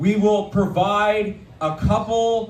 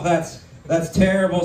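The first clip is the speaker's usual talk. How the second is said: ordinary talk, nothing marked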